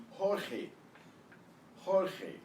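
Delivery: "Jorge" as HOR-kheh, J a throaty h; exaggerated Spanish pronunciation. Shown as a demonstration. Teacher modelling "Jorge" for students to repeat, exaggerated Spanish sounds. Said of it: "trying to exaggerate a little bit".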